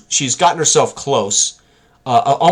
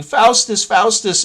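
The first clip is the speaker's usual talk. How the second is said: higher-pitched yell of the name, retold; mock scream; 8 semitones higher, slower than usual. Young girl screaming the name at the guards, high mock yell. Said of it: "you hear Joy screaming".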